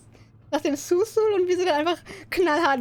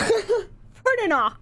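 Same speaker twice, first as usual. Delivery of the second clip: fake Simlish; 9 semitones higher, 4 dB louder, much slower than usual. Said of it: "Ich kann jetzt nicht auf Simlish reden".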